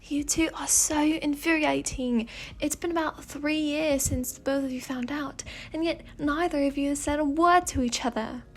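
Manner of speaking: put-on exasperated British-accented complaint; Fate's British accent. Fate complains about the soulmates' silence, British accent. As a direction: grumble at them in a British accent. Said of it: "speaking in a British accent".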